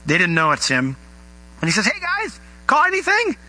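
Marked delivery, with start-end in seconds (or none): 1.8-3.4 high-pitched hail to distant listeners; calling out. Acted. Jesus on shore to fishermen, calling out. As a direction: call across the water in a raised pitch.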